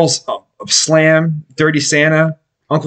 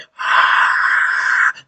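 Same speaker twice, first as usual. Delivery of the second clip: long breathy crowd roar; imitated crowd noise. Shown as a demonstration. Podcaster demonstrating his sound production, imitated crowd noise. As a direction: mimic a crowd roaring on one long breath.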